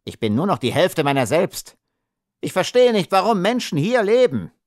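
high, wailing lament; a wretched little man fed up; agitated complaint. A narrator's reading.

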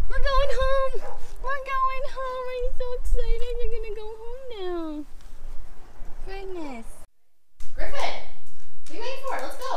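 high excited dog-mom voice; excited pet talk. Real, not acted.

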